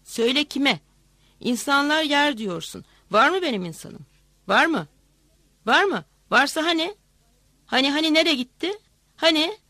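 furious shouted questions; shouting and ranting. A narrator's reading. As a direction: rant at the tax collector without waiting. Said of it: "bağırıp çağırıyor".

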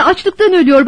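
insistent narrated outburst; sudden shout. A narrator's reading. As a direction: cry it out as a boy pleading for help. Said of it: "Çocuk birden bağırdı".

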